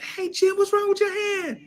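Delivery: high, concerned aunties' voice; aunties asking. Retold or imitated. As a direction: play the worried aunts. Aunties asking about the hand.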